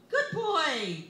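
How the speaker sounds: loud high praise; loud high good-boy praise; praising like a dog. Acted. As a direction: cheer him on like a pet. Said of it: "testing and praising my child like a dog".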